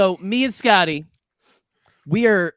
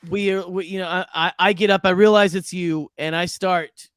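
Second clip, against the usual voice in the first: normal talking voice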